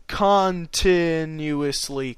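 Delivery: CONTINUOUSLY very slow, syllable by syllable; "continuously" drawn out with deliberate care; sounded out slowly. Real, not acted. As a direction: stretch out each syllable of "continuously". Reader sounds out a stumbled word.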